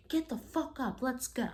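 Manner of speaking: high, mock-angry order. Boyfriend ordering drunk girlfriend off the ground, very angry. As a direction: imitate an angry boyfriend's command. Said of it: "very angry".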